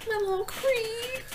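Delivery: tender. Real, not acted. Mother about her son.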